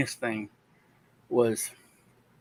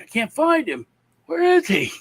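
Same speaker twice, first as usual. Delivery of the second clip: high, louder mock-worried search; hammed-up where-is-he, pitched way up; 14 semitones higher, 5 dB louder than usual. Dad pretending to search the dark room, high mock worry.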